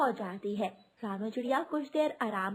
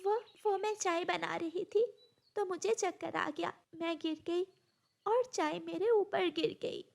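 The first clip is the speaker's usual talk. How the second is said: higher, tearful hesitant excuse; helpless tearful; 7 semitones higher than usual. Acted. Bride hiding the abuse from the doctor, tearful and higher. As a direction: cover for her with a shaky lie. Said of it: "बेबसी के आंसू थे".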